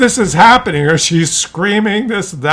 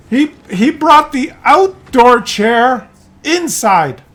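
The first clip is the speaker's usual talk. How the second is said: put-on higher, very slow, halting old lady voice; slow old mother; 7 semitones higher, slower than usual. Mother explaining the chair to her son, slow high halting voice. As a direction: stumble through it slowly.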